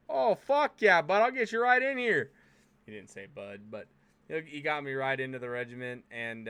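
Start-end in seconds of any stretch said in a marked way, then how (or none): 0.1-2.3 cheerful high, loud Scottish voice; welcoming Scottish accent, much higher; Abtots Scottish accent. Retold or imitated. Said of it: "that's my best impersonation of him".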